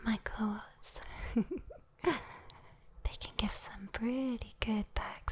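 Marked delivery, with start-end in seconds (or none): none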